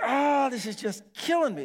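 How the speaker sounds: high pained moan. Retold or imitated. Pastor moaning in the stranger's car, high and pained. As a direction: whimper it like the ear is burning. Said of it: "like, ... You know, like this".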